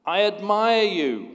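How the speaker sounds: a high, loud call of admiration; a father calling praise to the mountain; shouted praise. Acted. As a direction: shout it out across the valley. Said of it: "he screams to the mountain".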